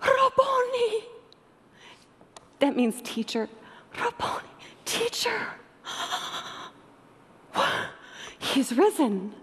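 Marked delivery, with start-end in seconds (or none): 0.0-1.0 high, slow, tearful cry; drawn-out high-pitched gasp of recognition; astonished Mary. Acted. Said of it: "And she goes". 4.0-9.3 breathless, gasping wonder; high, airy awe sinking to breathy disbelief; astonished Mary. Acted. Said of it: "And she goes".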